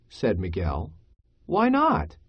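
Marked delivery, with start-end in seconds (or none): 1.5-2.2 high-pitched, puzzled objection; surprised protest. Acted. Miguel questions Rico about the cancelled game, surprised protest. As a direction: question it in higher-voiced disbelief.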